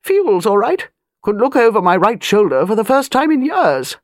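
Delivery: put-on an old lady of rank, talking down a little; airy grande dame, lightly done; lightly voiced imperious old lady.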